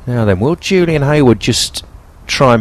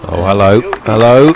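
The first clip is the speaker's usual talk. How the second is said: surprised. Real, not acted. Surprised call at the shot.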